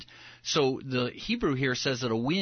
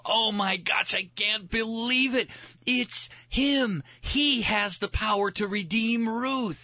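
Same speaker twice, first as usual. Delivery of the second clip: much higher, excited disbelief; thrilled realization; 9 semitones higher, slower than usual. Pretend. Listener in the synagogue, overjoyed and amazed. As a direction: burst out as the truth dawns.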